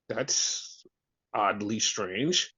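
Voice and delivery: low, slow, hushed deadpan; deadpan disbelief. Retold or imitated. Podcaster quoting himself, low slow deadpan disbelief. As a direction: say it like a dry, unimpressed aside. Reacting to news, deadpan inner voice.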